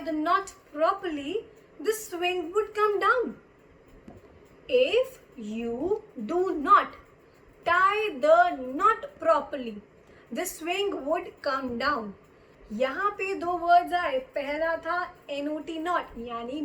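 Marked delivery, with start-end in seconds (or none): 4.7-12.1 very slow, deliberate teacher's reading; drawn-out words with pauses between phrases; slow repeat. Shown as a demonstration. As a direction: spell it out word by word for learners. Slow repeat of example sentence.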